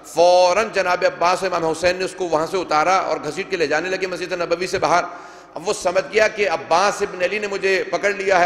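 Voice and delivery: ordinary talk, nothing marked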